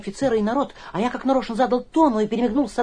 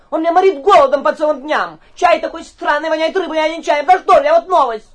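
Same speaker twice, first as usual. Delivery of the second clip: indignant ranting; 4 semitones higher, 8 dB louder than usual. Acted. Guest complains loudly to the mayor.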